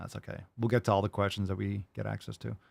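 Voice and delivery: ordinary delivery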